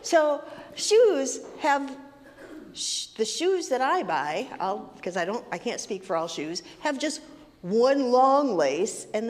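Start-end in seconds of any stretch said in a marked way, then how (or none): none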